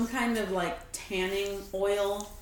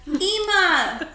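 put-on New Zealand accent; 7 semitones higher, 5 dB louder than usual. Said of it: "Rate my New Zealand accent".

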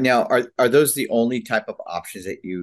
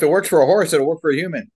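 high, louder imitation of their reasoning; joking mock quote; 7 semitones higher, 7 dB louder, faster than usual. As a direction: voice their logic loudly with amusement. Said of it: "They're like".

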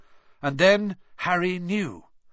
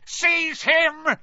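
scream, higher and louder; 13 semitones higher, 5 dB louder than usual. A narrator's reading. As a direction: order it at the top of the lungs. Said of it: "Voldemort screamed".

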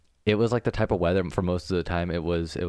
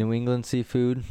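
normal talking voice

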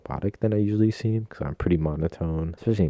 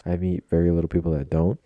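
usual speaking voice

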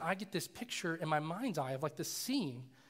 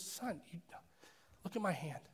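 much softer, hushed acted father; calm fatherly reassurance; 8 dB quieter than usual. Dad answering his frightened son, quiet reassurance. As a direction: drop the volume to settle a panicking child.

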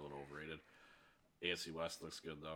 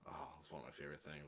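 normal talking voice

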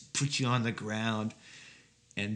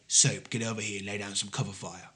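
mock British commander; 3 semitones lower, 4 dB quieter, faster than usual. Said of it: "They say".

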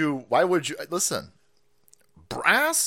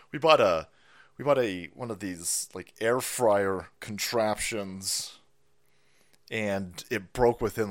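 usual speaking voice

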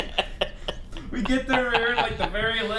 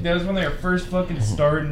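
ordinary delivery

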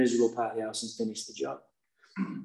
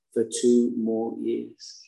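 slow and grave; slow, weighty; 2 semitones lower, much slower than usual. Real, not acted. Recovering drunk telling the crowd, slow and grave. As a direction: let each word land slowly.

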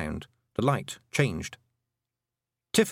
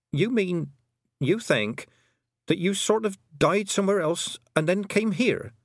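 higher-pitched, hesitant questioning; Tiffany, puzzled young heroine; 9 semitones higher than usual. Acted. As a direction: work it out aloud in a raised pitch.

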